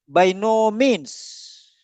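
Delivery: "By no means" hugely stretched out; slow, emphatic restatement. Shown as a demonstration. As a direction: draw out each word to drive the idiom home. Teacher restates idiom for emphasis.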